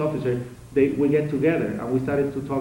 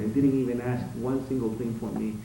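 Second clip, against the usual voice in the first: usual speaking voice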